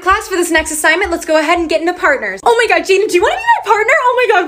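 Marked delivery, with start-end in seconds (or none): 2.4-4.5 put-on high, excited girly gush; suddenly squeaky voice. Girl asking Jaden to be her partner, voice suddenly high. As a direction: let the voice jump up, gushing. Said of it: "why did my voice just sound like that".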